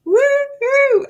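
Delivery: high, loud joyful whoop; excited whoop. Real, not acted. Host celebrating with her live audience, loud excited whoop.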